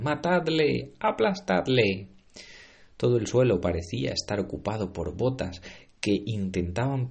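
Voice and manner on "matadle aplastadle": high furious yells; angry shouting. Acted. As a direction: bark it out, wanting blood.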